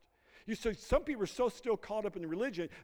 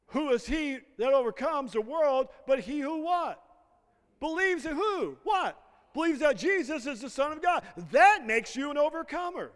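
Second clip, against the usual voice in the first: higher and louder preacher's call; rising pulpit fervour; full-force preaching; 6 semitones higher, 5 dB louder than usual. Acted. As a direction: preach it out to the congregation. Climax calling on the congregation.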